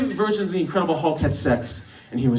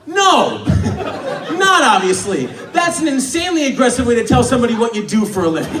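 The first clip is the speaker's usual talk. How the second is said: hammed-up indignant shouting; exasperated objection; 2 semitones higher than usual. Comic outburst at passenger's answer.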